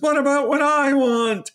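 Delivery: lightly voiced whiny child; mock plaintive child. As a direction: plead up high like a left-out kid. [name] pleads to parents.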